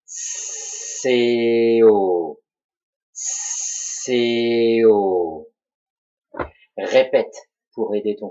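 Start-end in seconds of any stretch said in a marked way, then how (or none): 0.1-2.4 C O drawn out from a long hissed s; slow syllable demo. Shown as a demonstration. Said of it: "Je le fais lentement". 3.1-5.5 C O drawn out, long sustained s and vowels; slow syllable demo. Shown as a demonstration. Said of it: "Je le fais lentement".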